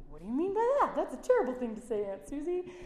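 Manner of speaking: put-on child appalled at her aunt's words; shocked child. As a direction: protest like a shocked kid to her aunt. Young self shocked at aunt.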